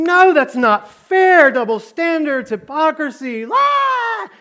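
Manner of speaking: high, loud indignant outcry; hammed-up protesting. Accused man objecting to hypocrisy, hammed-up loud protest. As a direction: wail each objection, ending in a squawk. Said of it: "he's protesting".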